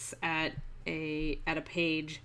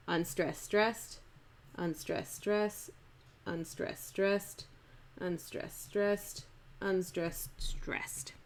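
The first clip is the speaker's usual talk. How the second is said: soft, rhythmic da-DUM chant, "stressed" stronger than "unstressed" each time; iambic stress pattern; 7 dB quieter, slower than usual. Shown as a demonstration. Teacher beating out five feet for a class, iambic stress pattern. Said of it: "the stress pattern is".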